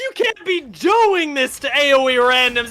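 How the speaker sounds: high, stretched-out cry of disbelief; exclaiming plea. Real, not acted. Caster begging Alorum to spare the Randoms, excited wail. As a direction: wail it out high, pleading with the player. Caster cries out at solo play.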